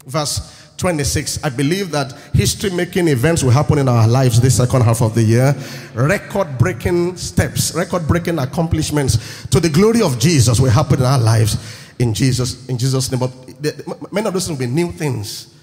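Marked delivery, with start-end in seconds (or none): 1.4-13.2 loud, low-pitched proclamation; full-force preaching; preaching declaration. Real, not acted. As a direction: declare it over the congregation.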